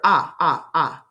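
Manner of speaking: "ah" repeated as a separate short vowel; short O sound. Shown as a demonstration. Teacher picking out the vowel, short O sound repeated. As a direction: isolate the vowel "ah", each one on its own. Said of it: "That's a short O sound".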